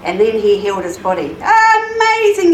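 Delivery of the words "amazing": high, loud, long-drawn cheer; yelling. A narrator's reading. Crowd amazed at the healing, yelled cheer. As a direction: stretch it out like a whole crowd marvelling. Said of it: "yelled the crowd".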